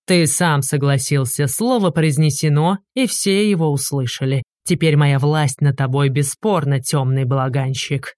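ordinary delivery

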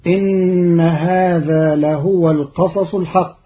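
لهو chanted with a damma, lahuwa; Quran recitation. Shown as a demonstration. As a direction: melodic tajweed chant. Verse recited before the rule.

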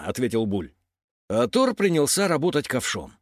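plain reading voice

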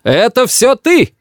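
high, drawn-out outburst; crying accusation. A narrator's reading. Grandmother blames grandfather, crying out angrily. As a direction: accuse him with a high cry. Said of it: "кричит бабушка дедушке".

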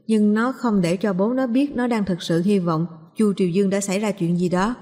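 usual speaking voice

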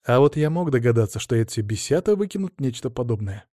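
ordinary delivery, nothing marked

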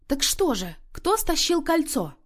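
plain reading voice

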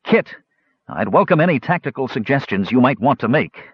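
plain reading voice

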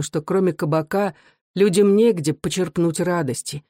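ordinary delivery

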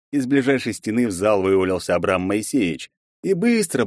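ordinary delivery

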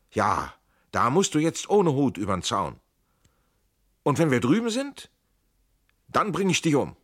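high, loud back-and-forth; heated exclaiming. A narrator's reading. Two fugitives argue at the fence, exclaiming loudly. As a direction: fire it at your comrade at full voice. Heated exchange under pursuit.

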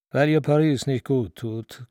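ordinary delivery, nothing marked

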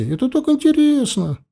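put-on children pestering mother for the story, high whine; whining kids. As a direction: nag like bored children. Children keep begging.

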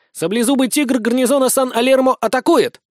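high, excited attack announcement; battle cry. A narrator's reading. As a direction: proclaim the attack like a young hero.

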